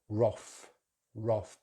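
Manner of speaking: wrath as roth; short o in wrath; British pronunciation. Shown as a demonstration. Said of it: "rather than".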